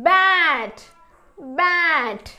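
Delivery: "బ్యాట్" loud, long vowel, falling; pronunciation demo. Shown as a demonstration. Teacher modelling the blended word, "బ్యాట్" loud and drawn out. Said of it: "ఎలా పలుకుతుందో చూద్దాము".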